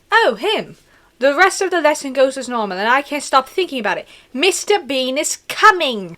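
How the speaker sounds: put-on animated story reading in a British accent; hammed-up British accent. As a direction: narrate in an earnest mock-British voice. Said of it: "Let me do a really good British accent".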